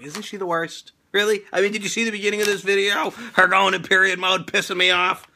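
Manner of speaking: usual speaking voice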